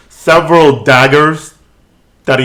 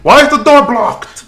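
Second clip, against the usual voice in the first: high, loud guard yelling through door; shouting guard; 12 semitones higher, 4 dB louder than usual. Acted. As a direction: call out sharply like a startled guard. GM voices guards behind door.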